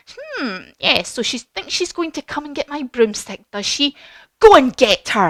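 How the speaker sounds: high, scheming witch voice; sly musing building to a loud command; Wicked Witch. Acted. Witch watching crystal ball, commands monkeys.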